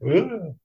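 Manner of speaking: high-pitched grossed-out groan; a quick mock shudder of a noise; squeamish "ugh". Squeamish at moving the worm.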